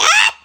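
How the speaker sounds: very high, loud mock scream; short hammed-up shriek; God's scream. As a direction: cry out high in mock horror. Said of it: "God, like".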